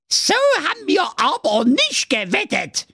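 very high, screeching witch outrage; furious witch. A narrator's reading. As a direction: protest as a fuming witch at the top of your range. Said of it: "wurde die böse Knusperhexe Ingrid Rachenbrand so zornig". (zornige Hexe, ganz hohe Stimme)

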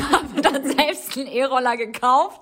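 laughs through the ironic boast, slow and loud; laughing at her own joke. Real, not acted. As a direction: giggle out the fake brag about money. Ironic boast about being rich.

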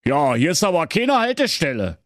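lightly voiced bored driver; a hint of an official; dutiful bus driver. A narrator's reading. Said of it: "erwiderte pflichtgemäß".

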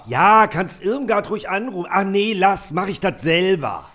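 very high, gushing mother voice; shrill-free but sky-high chatty mum showing off; proud mother on the phone. Acted. Podcaster reads the mother's lines bragging to Waltraud, in a very high put-on voice. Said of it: "Du bist Mutter".

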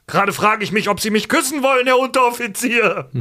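high, cheeky recruit yell; cheeky shout at sergeant. Retold or imitated. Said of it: "brüllt den U F Z an".

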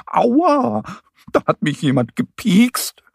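high yelp of a pricked mole; acted high, hurt outcry; pained cry. A narrator's reading. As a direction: cry out high in sudden pain. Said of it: "schreit er".

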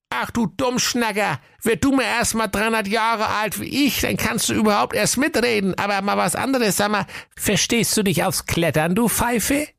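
put-on very high cranky old witch; angry witch. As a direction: scold him like an ancient hag. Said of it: "Die Hexe rief erbost".

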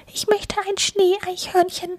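very high squeaky squirrel. A narrator's reading. Squirrel wishes for its own snow figure, very high. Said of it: "das eine sagt".

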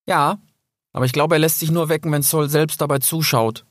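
a hint of a youthful fox; young fox character.

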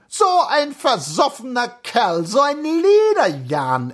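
high-pitched indignant cloakroom woman; worked-up indignation. A narrator's reading. Cloakroom woman railing about drunken Anton, worked-up indignation. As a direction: get heated, voice pitched right up. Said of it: "ereiferte sich die Gubalke".

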